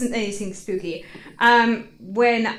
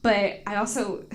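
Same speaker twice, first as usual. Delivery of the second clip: ordinary delivery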